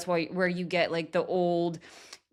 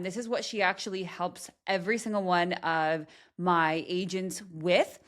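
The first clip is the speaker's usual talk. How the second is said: ordinary delivery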